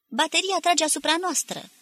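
high, loud alarmed yell from inside. A narrator's reading. Soldier inside the hut yells about the shelling, loudly. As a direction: shout the warning over the din. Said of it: "zbiera cineva înăuntru".

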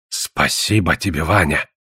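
low, slow, heartfelt thanks; old man touched, voice dropped low; deeply moved. A narrator's reading. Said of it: "Денгура был глубоко тронут".

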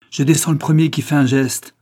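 high, loud warning cry; shouted threat. A narrator's reading. Sonia brandishing her pistol at police, shouting. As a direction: threaten the whole room loudly. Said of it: "elle s'écria".

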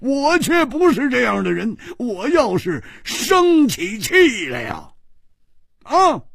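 threatening the deaf giant; angry threat. Acted. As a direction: work up to an outburst of temper. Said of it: "冷笑着说".

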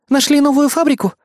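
high, alarmed gasp of a question; Kayli's horrified question. A narrator's reading. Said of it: "ужаснулась Кайли".

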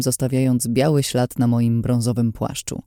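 ordinary delivery

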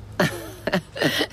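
a short breathy chuckle at his reply; laugh, charmed by his dry wit; amused laugh. Acted. Mother laughing at the detective's dry answer, amused chuckle.

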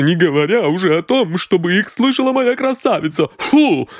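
a red-faced maid who has had enough; indignant maid. Acted. Maid throws the boy at the detective's feet, outraged shout.

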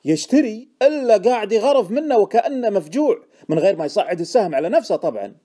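high, loud incredulous echo, then eager; excited emphasis. A narrator's reading. Informant correcting Deacon's mild question, excited emphasis. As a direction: top the question with loud zeal.

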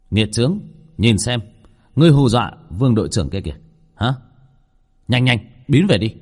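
loud, quick rebuke; scolding shout. Acted. Shop owner sending his ghost away, scolding shout. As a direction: order the ghost away sharply. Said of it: "ngẩng đầu quát lên".

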